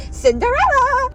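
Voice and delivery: usual speaking voice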